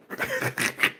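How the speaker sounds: short unvoiced high laugh; high squeaky laugh. Real, not acted. Laughing at his own Super Bowl jab with a high squeal. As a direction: let out a breathy squeal of a laugh.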